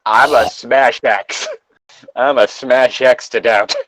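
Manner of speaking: ordinary talk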